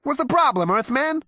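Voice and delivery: a cocky alien ribbing Arthur; Zaphod character. A narrator's reading.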